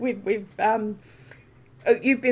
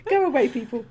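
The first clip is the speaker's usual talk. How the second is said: laughing dismissal; 4 semitones higher than usual. Real, not acted.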